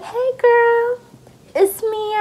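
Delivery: very high, drawn-out imitation of an aunt; high-pitched, put-on girly greeting; aunt's phone greeting. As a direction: sing out a familiar hello in falsetto. Voicing her aunt on phone.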